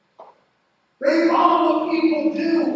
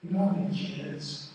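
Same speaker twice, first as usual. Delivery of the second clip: much softer, lower, intimate retelling; hushed storytelling; 10 semitones lower, 12 dB quieter than usual. Real, not acted. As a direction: drop the voice to a near hush for the painful memory. Long hushed painful childhood story.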